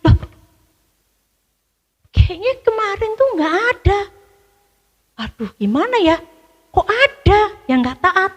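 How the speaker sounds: high, startled disbelief; surprised. A narrator's reading. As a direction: react shocked at the news. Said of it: "Pak Yosua kaget". (Yosua kaget, nada tinggi terheran-heran)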